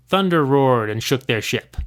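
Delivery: ordinary delivery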